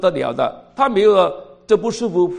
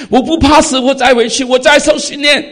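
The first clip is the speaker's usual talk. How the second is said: determined declaration; 7 semitones higher, 8 dB louder than usual. Retold or imitated. Sister declares resolve to return.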